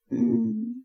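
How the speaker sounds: plain reading voice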